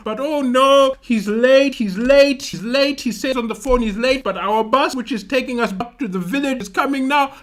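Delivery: high, insistent complaint; mock crying fuss. Workers telling the brother the man is late, mock crying fuss. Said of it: "all of them crying".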